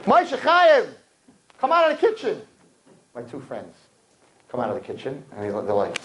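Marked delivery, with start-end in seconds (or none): 0.0-2.4 high call across the house; raised calling voice. Retold or imitated. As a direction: call him out of the kitchen. Calling out to the kitchen.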